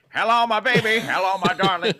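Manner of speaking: put-on lightly crooned old vaudeville tune; Michigan J Frog voice. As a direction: croon it like a singing cartoon frog. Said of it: "Michigan J Frog voice".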